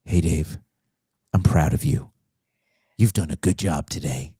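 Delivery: low, tender praise; sex line voice. Retold or imitated. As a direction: coo it like a proud lover. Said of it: "a woman to say".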